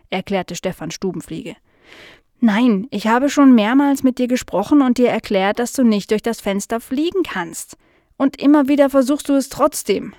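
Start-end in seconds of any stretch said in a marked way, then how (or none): 2.4-10.1 emphatic, lively scolding; exasperated. Acted. Nala reminding the forgetful fly, exasperated and emphatic. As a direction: insist with growing impatience.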